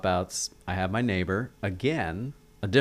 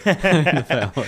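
laughing; 6 semitones higher, 10 dB louder, faster than usual. Real, not acted. Joking about family "mutants.

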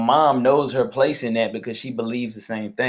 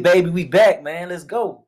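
hyped up; 6 semitones higher than usual. Real, not acted. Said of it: "somebody is super hyped today".

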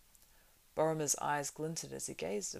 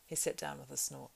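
ordinary delivery, nothing marked

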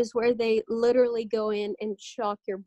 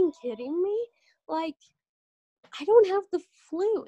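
higher, rising disbelief toward a doctor; incredulous outburst; 7 semitones higher than usual. Retold or imitated. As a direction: pitch up as if you can't believe it. Retelling reply to a flu test.

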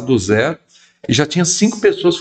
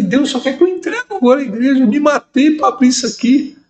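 much higher, fast, outraged plea; indignant protest; 13 semitones higher than usual. Retold or imitated. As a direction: protest the unfair order. Protesting God's order to give church.

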